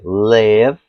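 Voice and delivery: "live" as "lev", ending on v; short e-like vowel. Shown as a demonstration. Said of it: "som do E".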